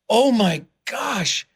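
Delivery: stretched-out astonished exclamation; awed realization. Retold or imitated. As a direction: draw it out in wonder. Retold moment of awed realization.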